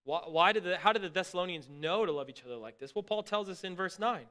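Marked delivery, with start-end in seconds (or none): none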